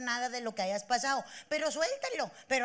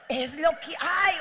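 loud, slow and firm; firm resigned line; 4 dB louder than usual. Shown as a demonstration. Coaching women on what to tell themselves, loud and slow. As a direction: say it with conviction. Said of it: "o sea, así".

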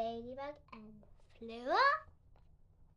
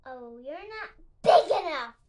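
much louder, scornful shout; grouchy ladybug; 3 semitones higher, 14 dB louder than usual. A narrator's reading. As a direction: yell it at the snake. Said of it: "said the grouchy ladybug".

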